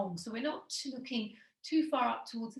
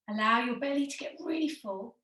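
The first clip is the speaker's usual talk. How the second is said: ordinary talk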